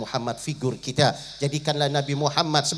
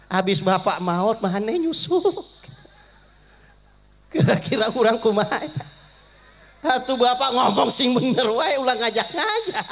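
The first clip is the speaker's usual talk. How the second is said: comic protest; 8 semitones higher, 4 dB louder than usual. Acted. Listener protests parent's invitation.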